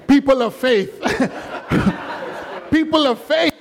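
high voice breaking into laughter; laughing. Real, not acted. Preacher teasing the people of faith, laughing high. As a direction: tease them through real laughter. Laughing at congregation's imagined reaction.

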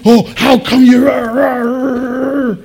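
loud, high cartoonish griping that drags into gibberish; mock ranting complaint. Preacher mocking his younger self complaining about dad, mock ranting. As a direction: imitate a petulant youngster's tirade.

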